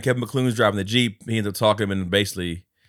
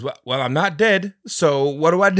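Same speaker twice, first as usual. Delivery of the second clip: high, loud mock-exasperated question; retold bafflement; 7 semitones higher, 6 dB louder than usual. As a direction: play a man at a loss at the embassy. Acting baffled man at the embassy.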